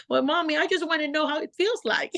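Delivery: put-on a son explains himself to his mother, childlike; little child. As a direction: answer like a curious little boy. Voicing her child's reply.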